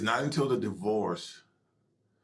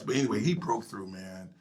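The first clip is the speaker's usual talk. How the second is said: ordinary delivery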